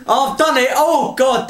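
breathless gasping; panting after a long rush; out of breath. Real, not acted. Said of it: "I'm out of breath now".

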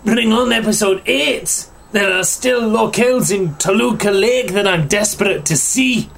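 reading the article in a put-on Scottish accent; mock Scottish voice. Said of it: "yours in a Scottish accent".

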